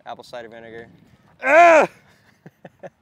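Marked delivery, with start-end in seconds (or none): 1.4-1.9 sudden loud yelp; sharp startled yell. Real, not acted.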